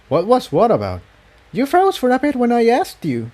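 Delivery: a worried pony pressing her friend; lifted voice for the uneasy mare; Tiptoe, puzzled. A narrator's reading.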